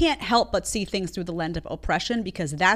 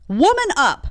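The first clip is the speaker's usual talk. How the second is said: loud, firm "Woman up"; scolding call to toughen up; forceful command; 6 semitones higher, 6 dB louder than usual. Real, not acted. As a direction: bark it as an order. Telling offended women to toughen.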